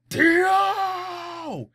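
high, loud furious scream of a name. Retold or imitated. Jonathan kicks down the door for Dio, high furious scream. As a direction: bellow the rival's name full force.

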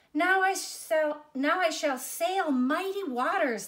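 eager high and loud, with a restart; the tree bursting with hope as it falls; excited tree. A narrator's reading. Tree dreams of sailing ships.

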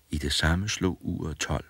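usual speaking voice

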